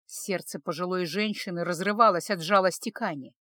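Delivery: ordinary delivery, nothing marked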